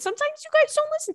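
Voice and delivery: playful exasperation. Real, not acted. Scolding listeners playfully.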